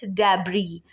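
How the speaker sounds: normal talking voice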